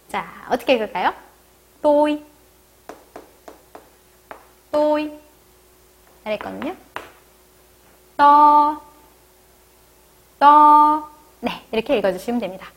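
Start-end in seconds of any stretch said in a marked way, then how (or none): 1.8-5.1 또이 with a short light ㄸ, high level pitch; light tense t. Shown as a demonstration. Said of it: "약하고 짧게 발음하는 쌍디귿". 8.2-11.1 떠 with a short ㄸ, loud high held vowel; light tense t. Shown as a demonstration. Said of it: "약하고 짧게 발음하는 쌍디귿".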